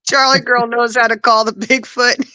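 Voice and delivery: talking through real laughter; laughing. Real, not acted. Laughing while recalling a funny story.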